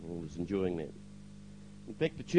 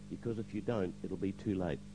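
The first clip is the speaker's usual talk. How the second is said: soft, lowered retold warning; hushed warning; 4 semitones lower, 9 dB quieter than usual. Pastor reveals what God told him, hushed warning. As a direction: repeat the warning gently and low.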